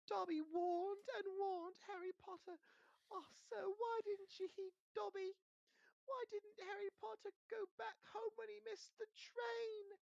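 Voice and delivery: very high, slow, tearful elf voice; a tiny servant sobbing out his plea; Dobby begs Harry, crying in a squeaky voice; weeping house elf. Acted.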